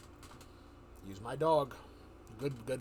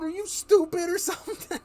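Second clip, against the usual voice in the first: much higher, louder mocking question; incredulous taunt; 13 semitones higher, 9 dB louder than usual. Teller mocks the dog-man's fake bark, loud and incredulous. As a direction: tease him with loud disbelief.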